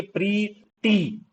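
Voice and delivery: प्रीटी split slowly into pree and tee; slow syllable-by-syllable प्रीटी; vowel-sound demo. Shown as a demonstration. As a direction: hold each ee in प्रीटी. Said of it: "आई वाली sound आ रही है".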